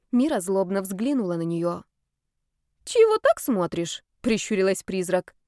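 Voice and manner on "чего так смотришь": teasing. Acted. Ghost teasing with squint.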